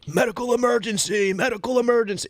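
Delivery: imitating a panicked shout without full volume; imitated scream. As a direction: call it out high like an alarmed passenger. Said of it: "somebody starts screaming".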